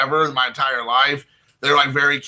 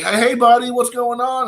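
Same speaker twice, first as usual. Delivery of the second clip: high, cooing pet voice; 7 semitones higher than usual. Real, not acted. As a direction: coo at the cat. Greeting his cat.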